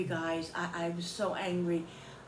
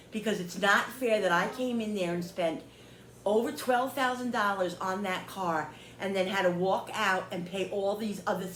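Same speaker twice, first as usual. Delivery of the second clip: ordinary delivery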